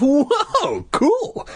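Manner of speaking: high, giddy awe; laughing cry. A narrator's reading. Boy delighted by the monster, laughing cry. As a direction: squeal it through a laugh. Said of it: "Luke started to laugh".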